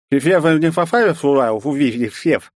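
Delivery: words through a juicy bite; mouth full. Acted. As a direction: talk through a mouthful of fruit. Talking with mouth full of fruit.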